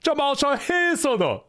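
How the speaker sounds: jokey Chinese phone patter, close to laughing; Ocelot speaking Chinese. Acted. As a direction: play Ocelot improvising in Chinese. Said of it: "he was gonna start speaking Chinese".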